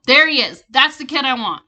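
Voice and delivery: loud, eager call. A narrator's reading. Company president calling out across the waiting room. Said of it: "he pointed and called".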